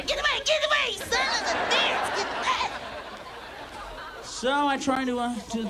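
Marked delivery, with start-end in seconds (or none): 0.0-2.7 high shrill get-away screech; mock shrieking woman. Woman fleeing the comic after the show, high mock shriek.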